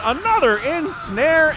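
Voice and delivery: high, slow, loud excited call. Acted. Caster calling the decisive ensnare, high and loud. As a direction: draw it out as the play lands.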